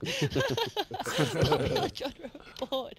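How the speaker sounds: slow, giggling reread; laughing through it. Real, not acted.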